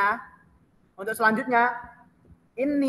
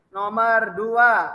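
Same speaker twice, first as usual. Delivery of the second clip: dictating; 3 dB louder, much slower than usual. Shown as a demonstration. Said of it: "lanjut ke soal".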